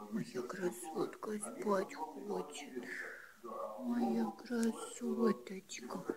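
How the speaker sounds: slow, soft lulling voice; sleepy lulling. Real, not acted. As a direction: lull her slowly to sleep. (убаюкивающе)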